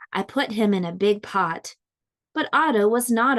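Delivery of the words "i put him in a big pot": usual speaking voice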